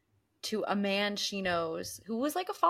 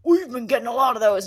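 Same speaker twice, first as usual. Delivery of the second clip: male cop voice; 3 semitones higher, 10 dB louder, faster than usual. Retold or imitated. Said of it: "the male cop goes".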